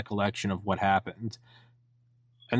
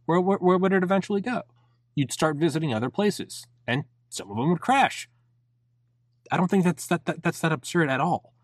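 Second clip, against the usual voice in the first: excited; 8 semitones higher than usual. Real, not acted. Excited argument for alien visits.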